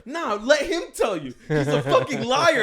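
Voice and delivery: high, loud mock accusation; imitated angry yelling. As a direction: imitate an irate friend shouting her down. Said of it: "Tim keeps yelling".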